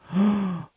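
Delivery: sharp gasp of surprise; startled intake of breath; shocked gasp. Real, not acted. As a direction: gasp at a discovery. Shocked by the note's date.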